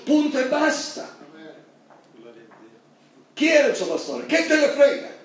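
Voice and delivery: fiery preaching. Acted. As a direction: drive the point home from the pulpit. Preaching rises to full force.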